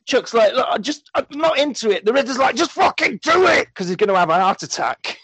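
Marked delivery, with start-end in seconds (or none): 0.5-2.0 higher-pitched, mock reluctant whine; acted-out reluctance, pitched up; reluctant protest. Film cop telling his boss he's not into it, mock reluctant. 2.6-3.7 high-pitched mock exasperated yell; exasperated outburst. The Riddler ordering a reluctant cop, mock exasperated yell.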